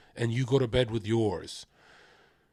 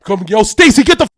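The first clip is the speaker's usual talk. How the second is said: much higher, much louder screaming rant; furious yelling; 10 semitones higher, 15 dB louder, faster than usual. Retold or imitated. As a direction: scream her out of the apartment in a rage. Screaming Stacy out of apartment.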